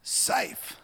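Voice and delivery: high incredulous exclamation; Mister Beaver. Acted. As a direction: throw the word back in disbelief. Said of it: "said Mister Beaver".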